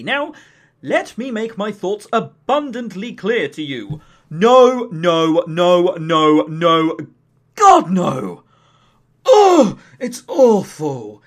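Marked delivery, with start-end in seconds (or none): 4.3-8.4 a string of loud, flat refusals, building to God no; emphatic loud no. Real, not acted. Reviewer slamming a bad game to viewers, loud emphatic refusal. 9.3-11.2 loud, slow groan of disgust. Real, not acted. Reviewer groaning to viewers about an awful game, loud slow disgust.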